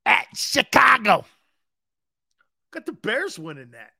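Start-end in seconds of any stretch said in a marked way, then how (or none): 0.1-1.2 high, slow, booming shout; big playful announcer yell; hammed-up announcing. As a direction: holler the city name out long.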